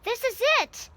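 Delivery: moaned. A narrator's reading. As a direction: moan it in defeat. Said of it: "Ron moaned".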